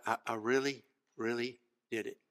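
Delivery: soft, low, halting admission; hushed retelling of a boy's earnest words; quiet confiding. Retold or imitated. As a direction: let it sink in softly. Boy quietly confides to coach.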